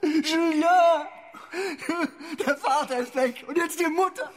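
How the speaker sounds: very high, loud anguished wails; wailing grief. Acted. Parent crying for the son left without a mother, wailing. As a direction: cry out in despair, voice pitched right up. Du kannst nichts anderes als heulen.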